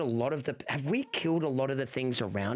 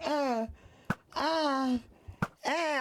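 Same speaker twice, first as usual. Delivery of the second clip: short higher grunts, one per blow; imitated hitting grunts; 9 semitones higher, much slower than usual. Streamer reenacting hitting Loki, rhythmic mock grunts. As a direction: mimic swinging at Loki again and again. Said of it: "I just kept going".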